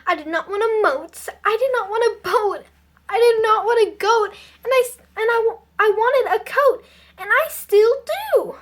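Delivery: loud, insistent grievance; crying out in protest. A narrator's reading. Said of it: "cried Maggie".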